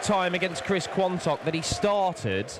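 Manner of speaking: rising thrill at the maximum; excited commentary. Real, not acted.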